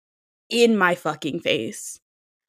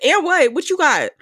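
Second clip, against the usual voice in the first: high, loud challenge; retold taunt, raised and pushed; mock squaring up; 10 semitones higher, 4 dB louder than usual. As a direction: hype it like you're ready to fight. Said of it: "I was like".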